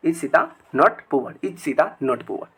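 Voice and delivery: is sita not poor said very slowly; slow dictation. Shown as a demonstration. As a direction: read it out slowly for learners. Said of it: "समझ में आ गया?". (धीरे-धीरे पढ़कर बताना)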